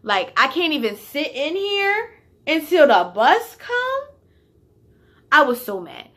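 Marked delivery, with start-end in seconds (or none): 0.4-4.0 high, loud indignant outburst; furious retort, voice pitched up; retold snapping. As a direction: snap back in disbelief. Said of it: "I snapped".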